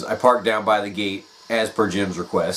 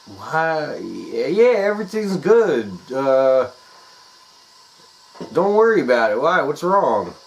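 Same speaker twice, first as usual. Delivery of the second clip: nervous; 5 semitones higher than usual. Retold or imitated. Nervous caller.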